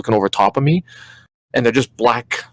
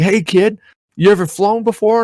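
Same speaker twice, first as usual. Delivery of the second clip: higher, breezy heroic invite; cheerful superhero to a kid; 10 semitones higher, 3 dB louder than usual. Retold or imitated. Superman asks the kid to fly, breezy. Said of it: "And he's like".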